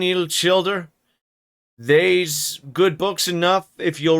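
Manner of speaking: put-on a stern old Yorkshire servant drawling his sermon; old servant's rural accent. Old Joseph scolds the children in a slow broad rustic accent. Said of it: "trying my best to read Joseph's lines".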